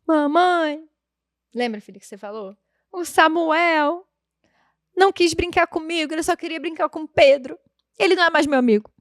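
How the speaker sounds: put-on a mild take on a hurt little girl; light child voice telling of a friend; imitating her daughter. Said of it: "ela calma e fala".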